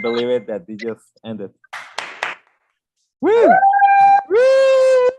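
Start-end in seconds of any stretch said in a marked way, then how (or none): 3.2-5.1 high, loud whoop; excited cheer. Real, not acted. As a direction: cheer at the top of your voice. Cheering at stream end.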